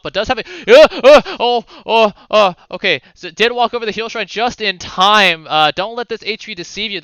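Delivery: esports commentary jumping to startled oohs; live play-by-play. Acted. Caster to viewers as Azir barely survives, animated play-by-play. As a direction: call a near death, then settle.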